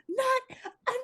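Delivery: very high, loud screech; furious shrieking. Acted. Shriek chases Jeremiah with the rat tail, furious screech. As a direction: screech each word apart, drawn out. Shriek screams while chasing with whip.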